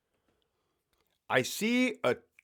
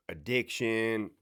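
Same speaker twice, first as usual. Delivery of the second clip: ordinary talk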